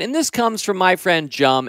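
ordinary delivery

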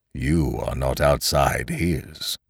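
low, slow, weighty smith; lightly voiced low blacksmith; smith's voice. A narrator's reading. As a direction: answer slowly in a low voice as the smith. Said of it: "answered the smith".

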